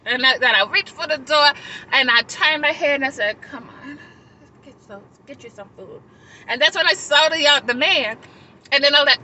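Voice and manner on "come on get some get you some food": very soft, resigned retelling; grudging consent. Retold or imitated. Speaker turning back to the panhandlers, quiet grudging consent. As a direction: give in quietly, voice dropping away.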